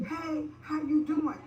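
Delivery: mock greeting. Quoting a man's greeting.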